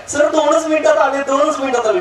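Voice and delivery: syrupy wife promising two minutes; lightly mimicked sweet phone reply; sweet lady on the phone.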